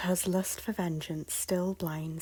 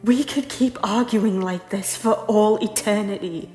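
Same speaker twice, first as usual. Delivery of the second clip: loud, forceful pleading; impassioned argument; 9 dB louder than usual. Acted. Lady arguing with her lord to end their conflict, loud and impassioned. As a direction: plead hard at full voice. Heated quarrel with tyrant lord.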